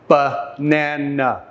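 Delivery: banana broken slowly into three separate sounds; syllable by syllable. Shown as a demonstration. Teacher showing students three sounds in banana, slow syllable by syllable. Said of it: "We hear three sounds".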